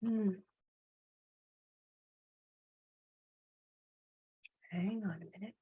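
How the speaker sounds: very soft, absent aside; talking to oneself. Real, not acted. Host to herself while shifting something mid-event, quiet aside.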